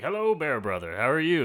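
loud, high, cheery hello to a bear; jolly greeting. Retold or imitated. Ranger greets a bear, loud and jolly. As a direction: hail the bear like an old friend. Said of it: "and be like".

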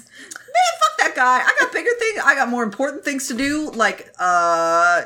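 put-on squeaky, bratty little girl. Voicing bratty Ah Ching.

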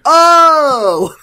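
loud, high sudden "oh"; a burst of realisation; surprised. Real, not acted.

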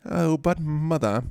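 put-on high, drawn-out British child's protest; slow mock-British pleading; British accent. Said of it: "know why he was British".

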